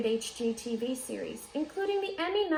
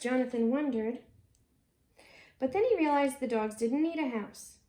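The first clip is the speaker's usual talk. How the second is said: plain reading voice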